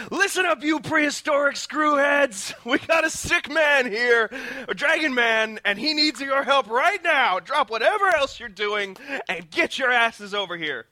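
high-pitched commanding shout; screamed order. Acted. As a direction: holler at the whole hall to demand help.

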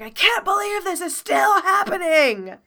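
high, loud furious shout, half laughing; cartoon villain yelling in exasperation; fuming villain. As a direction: rage at the heroes foiling you again. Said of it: "Jedi is fuming".

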